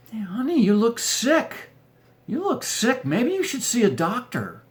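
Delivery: high, lightly put-on wife voice. Wife urging her husband to see a doctor, high voice.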